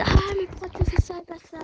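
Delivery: high-pitched imitation of complaining viewers; spectateur mécontent. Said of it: "vous vous dites". (voix aiguë de spectateurs qui râlent)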